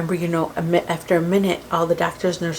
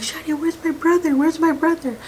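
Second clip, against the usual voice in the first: much higher-pitched retold frantic demand; frantic brother-in-law; 10 semitones higher than usual. Husband's brother runs in asking for him, frantic. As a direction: imitate a panicked man searching, without full volume. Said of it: "And just screaming".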